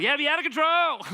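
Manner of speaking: high, loud party-animal yell; wild whoop. Acted. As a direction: whoop it up with total abandon. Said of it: "I wanna be careful about this one".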